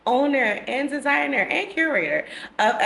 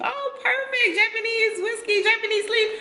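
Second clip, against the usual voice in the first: much higher delighted squeal; excited. Real, not acted. Delighted by coincidence.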